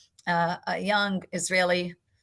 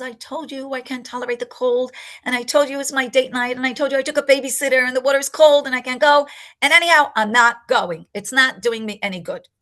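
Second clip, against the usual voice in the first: mock yell building louder and higher; retold angry yelling; 4 semitones higher, 6 dB louder than usual. Friend yelling at the narrator over cold water, an angry rising yell. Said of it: "she starts yelling, and she starts screaming".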